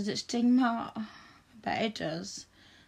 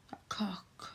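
whisper; 8 dB quieter than usual. Acted. Whispered aside to the baby boy.

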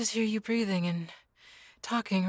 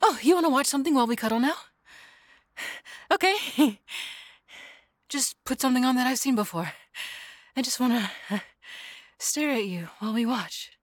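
fast, high and louder, bubbly; eager chatter; 5 semitones higher, 3 dB louder than usual. Acted. Girlfriend eagerly planning a cuddle movie, fast and bubbly. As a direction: rattle it off excitedly. Eager to watch something together.

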